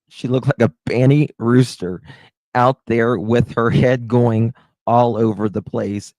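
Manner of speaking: can barely get it out for laughing; laughing hard. Real, not acted. Streamer repeating the rooster joke, laughing hard. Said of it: "I'm crying".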